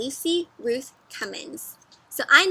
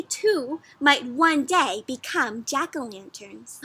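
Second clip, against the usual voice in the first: story narration; 4 semitones higher, 6 dB louder, slower than usual. Acted. Reads the book text aloud.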